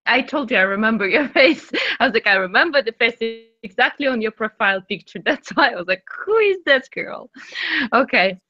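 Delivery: ordinary talk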